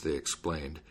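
ordinary delivery

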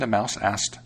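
ordinary delivery

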